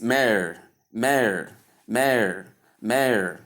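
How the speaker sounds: "mayor" as one syllable, like "mare"; "mayor" in one falling beat, "mair"; correct mayor. Shown as a demonstration. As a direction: say "mayor" to rhyme with "air". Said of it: "pronounce it as the word mayor".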